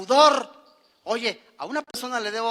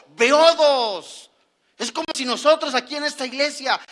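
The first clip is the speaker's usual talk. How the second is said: fiery preaching; 3 semitones higher, 5 dB louder than usual. Acted. Preaching at full force.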